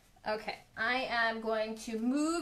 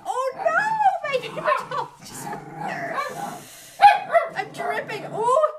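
much higher and louder, startled cries; alarmed; 12 semitones higher, 8 dB louder than usual. Real, not acted. Painter yelps to viewers as her paper towel drips. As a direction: yelp as the paint starts dripping.

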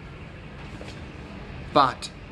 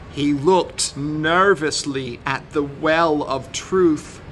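usual speaking voice

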